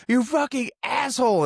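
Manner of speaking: high-pitched retold insult; mock angry outburst. Podcaster retelling a character lunging at Phil, high mock anger. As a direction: burst out as if about to lunge, half in fun. Acts out Phil being tackled angrily.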